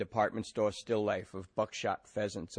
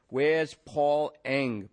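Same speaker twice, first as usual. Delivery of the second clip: very slow, louder and higher; blaring; 4 semitones higher, 4 dB louder, much slower than usual. A narrator's reading. Detective blaring a name at the karaoke men. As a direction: blare the name at the room. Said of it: "Maddie blared flatly".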